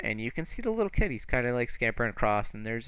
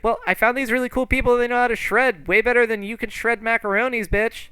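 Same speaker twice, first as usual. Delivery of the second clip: put-on high, fast, loud kid voice; bratty kid; 12 semitones higher, 7 dB louder than usual. Kid boasts about cool new friends, high and defiant. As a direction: rattle it off like a defiant little boy. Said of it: "he's like".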